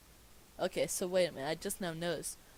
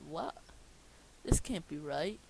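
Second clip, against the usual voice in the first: usual speaking voice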